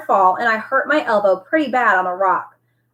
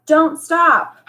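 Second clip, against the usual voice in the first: higher urgent yell while running; 7 semitones higher, 3 dB louder than usual. A narrator's reading. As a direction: shout back to keep him going. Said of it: "would yell".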